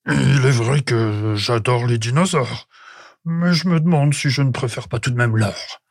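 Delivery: caveman's put-on voice, confessing; reluctantly admitting. A narrator's reading. Prehistoric man confessing he may prefer gold, reluctant. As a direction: admit it haltingly, in character. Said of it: "avoua l'homme préhistorique".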